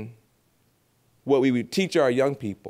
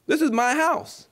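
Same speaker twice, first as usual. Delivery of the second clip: high, indignant mother voice; a mother telling off a messy child, high-pitched; 7 semitones higher than usual. Retold or imitated. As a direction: scold them as the mother whose house was wrecked. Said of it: "what you can say is".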